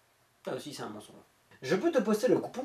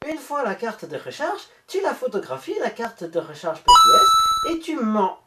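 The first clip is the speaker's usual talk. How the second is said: put-on German accent; 3 semitones higher, 4 dB louder than usual. Scammer giving instructions to the viewer, in a mock German accent. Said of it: "j'ai repris mon accent allemand".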